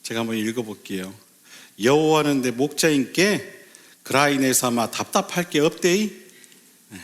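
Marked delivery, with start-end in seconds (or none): 1.8-6.1 Gyeongsang-accented Korean recitation; Gyeongsang dialect. Shown as a demonstration. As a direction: read the psalm in a put-on Gyeongsang lilt. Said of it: "먼저 경상도 버전이 있더라고요".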